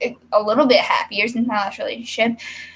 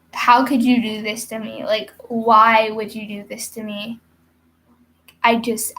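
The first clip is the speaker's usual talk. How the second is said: usual speaking voice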